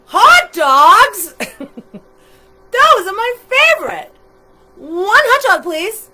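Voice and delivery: loud, high, delighted exclaiming; excited monster. A narrator's reading. Monster finding his favorite food, loud excitement. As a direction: burst out loudly with greedy delight. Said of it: "See how excited he is?".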